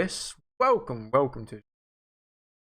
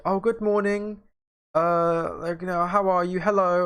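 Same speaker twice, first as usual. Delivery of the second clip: higher, bright mock shop-worker greeting; mock cheerful clerk; 6 semitones higher than usual. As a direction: play the chirpy clerk lightly. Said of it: "she was like".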